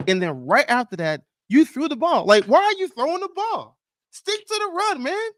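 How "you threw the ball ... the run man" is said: pitched way up in disbelief, pleading; high, incredulous rant at the playcaller; exasperated. Real, not acted. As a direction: throw your hands up at the coach's call. Venting at the coach's play call.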